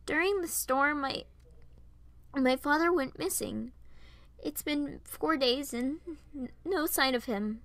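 worried, hesitant and pleading. Acted. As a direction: break the news anxiously, catching on words. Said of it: "could hear the worry in my tone".

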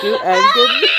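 high slow laughing sign-off. Real, not acted. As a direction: try to say it through laughter. Signing off, laughing.

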